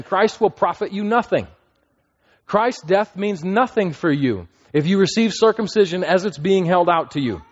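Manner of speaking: high, pressing declaration; emphatic Paul. Acted. Preacher paraphrasing Paul to the congregation, high and emphatic. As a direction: drive home that Christ counts for nothing. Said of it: "Literally, he's saying".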